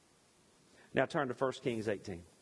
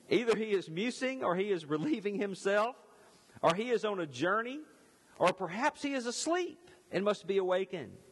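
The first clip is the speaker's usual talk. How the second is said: milder mocking; 6 semitones higher than usual. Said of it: "Elijah mocked them".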